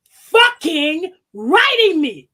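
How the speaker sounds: drawn out, slow and loud; slow angry stress. Retold or imitated. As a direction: stretch out fucking writing me in anger. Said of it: "I'm like".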